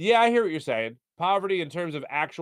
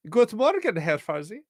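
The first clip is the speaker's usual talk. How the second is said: put-on higher, little German baby voice; son Leo's voice; 6 semitones higher than usual. Shown as a demonstration. Host rereading the chat greeting in his baby son's voice, high and childlike. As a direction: chirp it like a tiny German toddler. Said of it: "I'll say this in my son Leo's voice".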